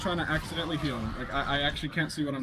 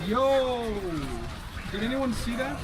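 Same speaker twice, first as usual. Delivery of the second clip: excited shout; 9 semitones higher, slower than usual. Real, not acted. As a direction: shout it out, thrilled by the play. Amazed at a play in game.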